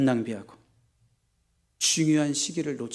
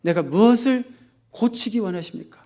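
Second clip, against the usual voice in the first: building higher and louder with each plea; a fervent, rising prayer of surrender; earnest pleading prayer; 7 semitones higher, 6 dB louder than usual. Retold or imitated. Pastor modeling the right prayer to God, rising in fervor. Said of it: "이런 기도를 드리길 원하시는".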